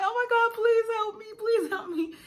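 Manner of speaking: high, rushed mock-desperate begging; frantic plea, imitated. Labouring woman to epidural guy, imitated frantic plea. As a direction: beg for help in an acted panic.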